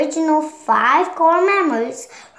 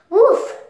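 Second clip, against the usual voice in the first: impact noise; 9 semitones higher, 6 dB louder than usual. Acted. They fall to the ground.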